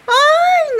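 high loud storyteller's ay of grief; hammed-up lament; mock wail. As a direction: wail it like a folk tale lament. Water carrier cries out.

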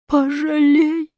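high, slow plea; little Chukchi boy begging for mercy; begging child. A narrator's reading. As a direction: beg for mercy. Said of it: "взмолился маленький Чукча".

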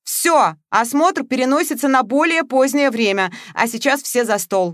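high, loud summons; host commanding. A narrator's reading. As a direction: call everyone to the table. Host summons guests to table. (Матвеев зовёт всех к столу, громко)

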